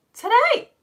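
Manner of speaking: high, loud, astonished rising question. Real, not acted. Teacher learning it is a pupil's birthday, astonished. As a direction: shoot the pitch right up in disbelief. Repeats birthday news, escalating disbelief.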